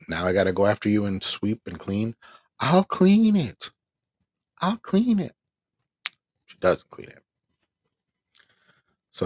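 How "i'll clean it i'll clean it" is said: high, slow mocking singsong of her mother; mocking mom's voice. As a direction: mimic mom brushing it off in a high, slow voice. Mimicking mom's reply.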